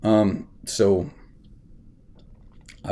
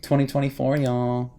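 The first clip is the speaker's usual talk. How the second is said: higher mocking prissy actress voice; mock impression of the actress, higher; imitating Rachel Zegler; 7 semitones higher than usual. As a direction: mimic her dismissive tone, pitched up. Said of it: "when Rachel Zegler was like".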